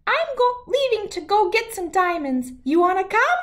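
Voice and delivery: a tiny shrew proposing teamwork. Acted. Shrew offers New to work together, tiny high voice.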